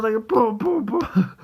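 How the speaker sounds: booms jumping up high, playful fight sounds; mock punch booms. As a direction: punch out each boom like a cartoon fight. Hulk punching Thanos.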